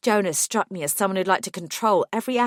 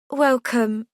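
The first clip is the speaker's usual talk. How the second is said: put-on light London accent, higher and friendly; faint London accent; 6 semitones higher, 5 dB louder than usual. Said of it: "she asked in a faint London accent".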